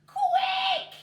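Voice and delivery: high, loud urgent shout. A narrator's reading. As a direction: yell to hurry her down. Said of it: "he shouted".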